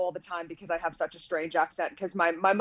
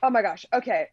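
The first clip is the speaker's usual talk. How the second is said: normal talking voice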